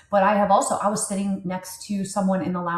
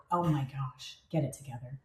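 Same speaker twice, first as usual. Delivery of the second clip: self-scolding mutter; 5 semitones lower, 8 dB quieter than usual. Real, not acted.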